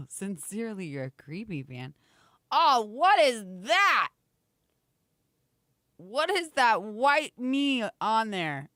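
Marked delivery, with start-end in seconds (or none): 2.5-8.7 slow startled what is that; surprised. Real, not acted. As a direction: draw it out, startled. Startled by screen image.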